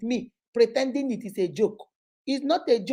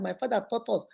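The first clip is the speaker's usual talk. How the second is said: ordinary talk, nothing marked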